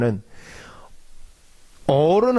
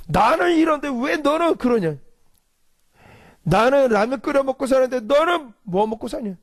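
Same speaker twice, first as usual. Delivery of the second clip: resentful rant; 8 semitones higher than usual. Retold or imitated. Resentful believer rants at enemy.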